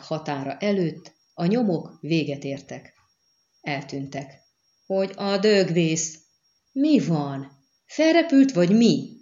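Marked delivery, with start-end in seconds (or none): 4.9-9.1 startled outburst, climbing high and loud; indulatos döbbenet. A narrator's reading. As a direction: demand an answer in disbelief. Shocked demands at a lost trail. (felháborodott, értetlen kérdés)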